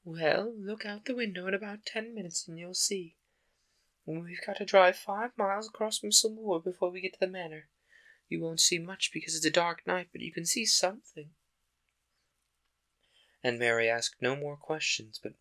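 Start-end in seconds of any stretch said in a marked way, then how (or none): 0.0-11.3 higher housekeeper voice; Miss Medlock voice. Acted. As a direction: answer the child matter-of-factly. Medlock answers Mary.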